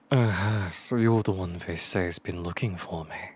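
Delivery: very low, loud character voice; Edgeworth. Acted. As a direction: drop the voice deep and sound imposing. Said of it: "I'm using the wrong voice for the wrong characters. I'll save that for Edgeworth".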